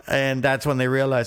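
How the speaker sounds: normal talking voice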